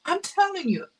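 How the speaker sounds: high, emotional, choked-up insistence; real welling-up, voice pitched high; tearing up. Real, not acted. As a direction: say it on the edge of tears. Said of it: "I'm tearing up here a little bit".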